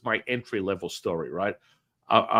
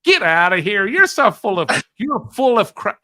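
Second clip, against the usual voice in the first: much higher and louder, breaking into a laugh; disbelieving outburst; 10 semitones higher, 10 dB louder than usual. Retold or imitated. Telling a friend she's full of crap, loud with laughter.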